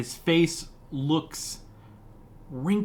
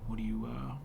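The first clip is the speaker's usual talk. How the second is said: low, gruff, half-whispered hermit; a hint of a gruff woodsman; 3 semitones lower, 11 dB quieter, faster than usual.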